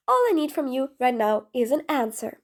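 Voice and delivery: ordinary talk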